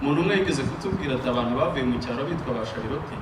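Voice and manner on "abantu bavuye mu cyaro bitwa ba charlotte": ordinary talk, nothing marked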